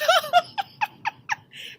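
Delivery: very high squealing laugh; loud high giggling fit. Acted. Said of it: "Then he laughed out loud".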